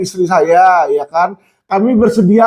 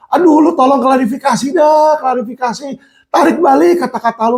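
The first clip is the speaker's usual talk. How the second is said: higher, urgent plea to take it back; desperate inmate; 7 semitones higher than usual. Retold or imitated. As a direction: press him hard, desperate. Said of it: "di lapas itu nelfon saya". (tahanan panik mendesak, nada tinggi)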